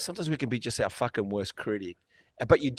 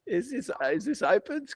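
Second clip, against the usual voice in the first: high-pitched incredulous thought; hushed disbelief; 11 semitones higher than usual. Retold or imitated. Competitor eyeing huge rivals backstage, in high disbelief. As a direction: wonder it to yourself in a high voice.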